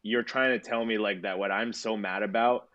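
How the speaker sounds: ordinary delivery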